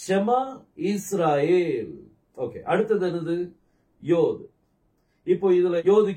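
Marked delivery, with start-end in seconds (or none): 0.1-1.9 ஷமா இஸ்ராயேல் very slowly, syllable by syllable; exaggerated pronunciation. Shown as a demonstration. Teacher asking students to say it together, exaggerated pronunciation. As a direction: lead a slow, stretched ஷமா இஸ்ராயேல். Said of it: "சேர்ந்து சொல்லுங்க பார்க்கலாம்".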